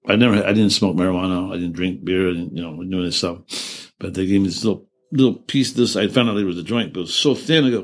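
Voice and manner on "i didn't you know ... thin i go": ordinary delivery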